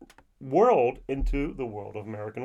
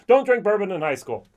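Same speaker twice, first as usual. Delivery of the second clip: higher and louder, punch DONT in don't drink; mock stern warning; 7 semitones higher, 7 dB louder than usual. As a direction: wag a finger: DONT drink bourbon. Mock scolding about drinking.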